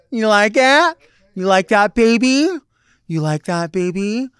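high, coy taunt; flirty teasing. Acted. Host teasing the audience, coy and high. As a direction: tease them like a flirt.

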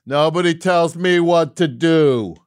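hammed-up tough-guy boast; mock defiance. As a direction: proclaim it like a rebel hero.

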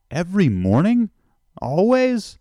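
loud, high rising question; acted amazement, pitch leaping up on the last word; incredulous. Visitor questioning a seagull feeder, incredulous.

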